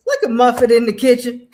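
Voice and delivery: mother chuckling at her daughter cooking; laughing mother. Retold or imitated. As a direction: laugh through the mother's teasing words. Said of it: "I can really feel my mother saying".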